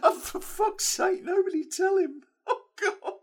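high, squeezed voice through laughter; helpless real laughter pushing the pitch right up; laughing plea. Real, not acted. Storyteller cracking up over the girl's kiss, laughing plea. Laughing at his own joke.

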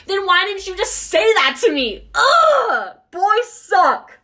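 very high, loud, hammed-up outburst; exasperated shout. Girl venting at the boy's late excuse, exasperated yelling. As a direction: yell it in frustrated disbelief.

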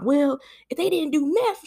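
high, snooty mock voice; mocking commenter. Parodying critics of shooting victims, snooty voice. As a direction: ham up a smug critic.

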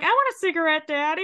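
put-on high-pitched little girl voice; Trinity. Daughter asking her daddy for a cigarette, childlike plea. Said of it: "Trinity's on the patch".

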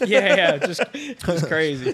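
laughing through it; laughing at himself. Real, not acted. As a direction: giggle out the yes. Laughing admission.

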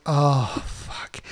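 low, hushed sinking curse; dismayed mutter. Retold or imitated. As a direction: let it sink into a near-silent whisper. Retold dismay at wife's honesty.

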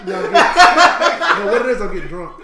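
laughing while talking. Real, not acted. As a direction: laugh through the words. Laughing at own mistake.

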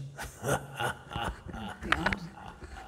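real staccato chuckle; laughing. Real, not acted. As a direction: let out a run of short laughs. Speaker laughs after the advice.

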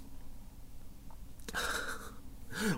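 quiet, breathy laughing fit; soft helpless laughter; real laugh. Real, not acted. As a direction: crack up softly at a funny memory. Cracking up at the memory.

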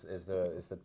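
ordinary delivery

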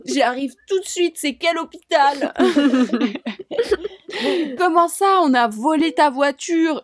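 high, hammed-up alarm at fake family news; pretending to take an emergency call to escape a date; mock shocked phone call. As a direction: play up the fake panic as if hearing bad news. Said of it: "j'enverrai des messages de S O S pour qu'on m'appelle et que je dise".

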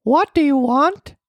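Pinocchio voice. Acted. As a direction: call out high and startled. Pinocchio asks the cricket.

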